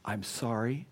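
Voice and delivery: soft, lowering sincere apology; repentant. Shown as a demonstration. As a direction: confess gently, slowing on the final plea. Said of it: "truly reflect a repentant heart".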